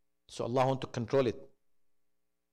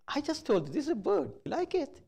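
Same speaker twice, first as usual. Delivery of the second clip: amused father; 12 semitones higher, faster than usual. Retold or imitated. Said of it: "I started laughing".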